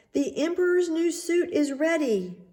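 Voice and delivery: high, eager rogue's announcement; excited announcing. A narrator's reading. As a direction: proudly present the finished suit. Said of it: "they cried at last".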